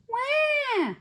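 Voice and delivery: high, loud imitated baby cry; baby's wail. A narrator's reading. Baby ghost wanting a diaper change, high loud wail.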